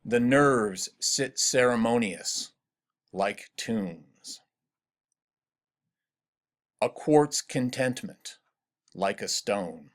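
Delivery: slow recitation, pausing before "like tombs" and "like a stone"; weighty, drawn-out stresses on "nerves" and "quartz"; measured verse rhythm. Shown as a demonstration. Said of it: "Listen to these lines".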